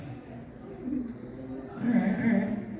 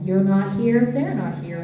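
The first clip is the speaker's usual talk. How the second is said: usual speaking voice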